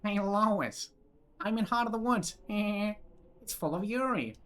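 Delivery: hammed-up cartoon dad hailing his wife; Peter Griffin voice. Streamer clowning as Peter Griffin.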